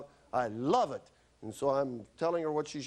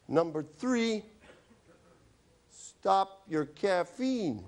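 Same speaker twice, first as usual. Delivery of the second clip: higher, very slow dictation; slow prescription; 7 semitones higher, much slower than usual. Acted. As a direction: lay out each word as an order to the patient. Writing the prescription out loud.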